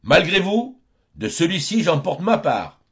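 a high, loud, boastful cry; loud taunt. Acted. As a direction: shout the boast back at the pursuers. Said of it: "Il s'écria à haute voix".

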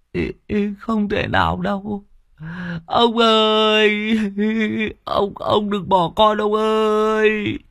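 a grandson on the verge of tears begging grandpa; tearful trembling boy. Acted. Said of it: "run run, mắt ngấn ngấn nước".

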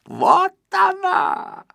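a sputtering rage at the TV; furious yelling. A narrator's reading. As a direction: shout it, spitting with fury. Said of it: "заорал, брызгая слюной".

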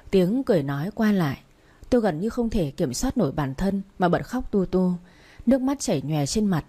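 ordinary delivery, nothing marked

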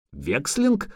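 incredulous rising question; exclaiming innkeeper. A narrator's reading. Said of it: "выкрикнул трактирщик".

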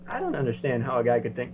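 usual speaking voice

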